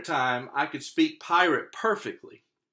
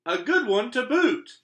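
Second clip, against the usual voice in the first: very high, loud crew echo; chorus it back like a rowdy crew; pirate crew agreeing; 11 semitones higher, 7 dB louder than usual. Acted. Crew echoing their captain, very high and loud. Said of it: "the others agreed".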